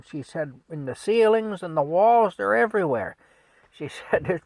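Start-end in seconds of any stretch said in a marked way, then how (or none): 0.7-3.2 high, loud complaint; worked-up nagging yell; yelling wife. Retold or imitated. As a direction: holler it as if besieged. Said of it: "she yells at Frank".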